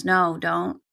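ordinary delivery

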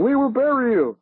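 high, table-pounding mock tirade; angry Soviet premier. Premier pounding the table at the enemy, mock furious shout. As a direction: play the furious premier, hammed-up. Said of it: "an angry Soviet premier pounding the table and going".

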